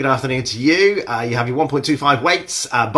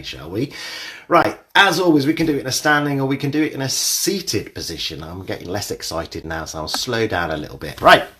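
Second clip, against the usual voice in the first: ordinary talk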